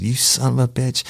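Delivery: retold curse, pitched well up; mock-furious cursing partner; angry. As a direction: spit it at him like a furious detective. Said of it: "fighting mad".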